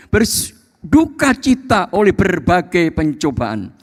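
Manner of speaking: high, forceful Bible reading; preaching scripture. Acted. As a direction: declaim the verse to the congregation. Reads scripture at full force.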